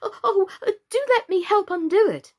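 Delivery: high, hesitant, eager plea; anxious eagerness. A narrator's reading. Alice offering to help the mouse, anxiously eager. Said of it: "looking anxiously about her".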